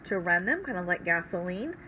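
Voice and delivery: normal talking voice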